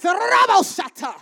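shouted tongues through clenched teeth; loud, slow prayer in tongues; through gritted teeth. Retold or imitated. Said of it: "I start talking through my teeth and praying in tongues".

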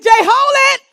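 very high, very loud call to stop; loud shout. Retold or imitated. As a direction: yell to stop him in his tracks. Teacher stopping the running boy.

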